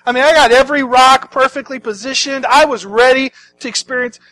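high, loud comic complaint; Lazarus protesting. Acted. Lazarus to the cheering crowd, protesting comically. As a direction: protest like someone rudely disturbed from comfort.

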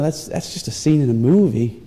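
ordinary talk

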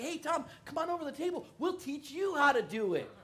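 put-on high, bright kid's call; friends calling. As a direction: wave him over like a scheming kid. Friends call him over.

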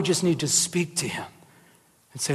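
hushed, intimate whisper; a soft aside whispered to the congregation. Real, not acted. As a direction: lean in and whisper it. Preacher drops to a hush.

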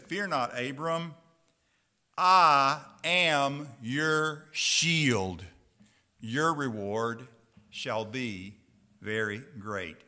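low, hushed reassurance; God reassuring. Retold or imitated. God reassures Abram, low and gentle. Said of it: "He said".

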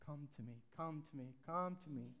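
soft, tender invitation; Jesus quietly coaxing the woman toward him, tender and soft; gentle calling. Acted. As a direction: coax her closer, very gently. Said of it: "It's so he can come out".

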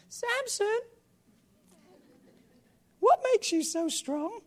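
high, sweet coaxing woman; coaxing Delilah voice. Acted. Preacher voicing Delilah coaxing Samson, high and sweet.

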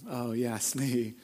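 low, quiet aside to yourself; sheepish admission. Acted. Parent realizing where the kids learned it, sheepishly low. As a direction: drop right down, owning up.

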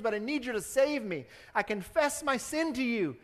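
loud, high, impassioned preaching; full-force preaching. Real, not acted. Preacher calling the congregation to salvation, loud and impassioned. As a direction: call them to salvation with fire.

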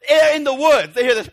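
high, loud, breaking with mock dread; hammed-up scary-movie tension; mock horror suspense. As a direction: sell the creepy moment like a campfire tale.

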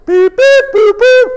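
very high toy-machine beeps; mock machine beeping; high electronic beeps. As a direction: chirp like a game box. Imitating Simon game.

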